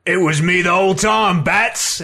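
gloating comic book Penguin. Retold or imitated. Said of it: "That, that's your Penguin voice?".

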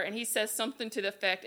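ordinary delivery, nothing marked

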